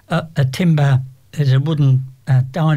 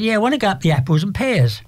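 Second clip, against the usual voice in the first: higher, quicker cockney repeat, lightly voiced; a light imitated cockney lilt, said quickly; cockney accent; 6 semitones higher, faster than usual. Cockney man repeating slang to a puzzled girl, light cockney accent. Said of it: "he was a bit of a cockney".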